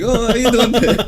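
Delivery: high, loud put-on greeting; mimicked greeting. Mimics guy's overfriendly greeting.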